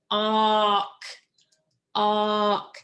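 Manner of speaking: "Awk" with the AW held long before K; sounded out. Shown as a demonstration. Teacher letting students count sounds, "awk" drawn out. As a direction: stretch the vowel in "awk", evenly pitched. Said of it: "how many sounds do you hear".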